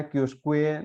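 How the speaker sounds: कियोस्क्वे with a "kweh" ending; wrong reading. Shown as a demonstration. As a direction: sound the written U in कियोस्क्वे as W. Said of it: "नहीं पढ़ना है". (कियोस्क्वे, अंत में 'क्वे' वाला गलत उच्चारण)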